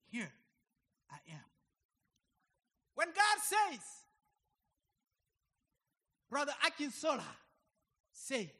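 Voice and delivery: loud, high calling out of names; preaching at full force. Acted. Preacher calling congregation members by name, loud and fervent. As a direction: summon each one by name.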